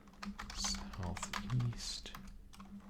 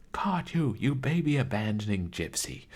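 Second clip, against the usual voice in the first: crying out; 7 dB louder than usual. Acted. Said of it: "she cries".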